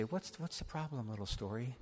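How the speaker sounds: high, soft coaxing voice; soothing the story like a small child; tender coaxing. Pretend. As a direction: coax it like a sulking child.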